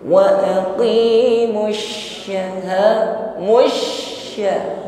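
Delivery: slow chanted "syah" with a long, spreading sh; "aqimu syah" recited high with doubled sh; spreading shin. Shown as a demonstration. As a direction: let the sh in "musya" hiss and spread. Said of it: "lebih nampak sifat tafasyinya, menyebar".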